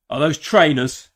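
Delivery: mock club bouncer eyeing the shoes.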